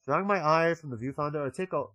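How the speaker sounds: ordinary talk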